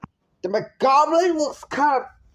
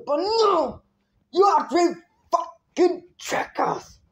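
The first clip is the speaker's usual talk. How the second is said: yelling; 7 semitones higher than usual. Real, not acted. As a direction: bark out the insult in real anger. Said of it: "Sorry for this yelling and screaming".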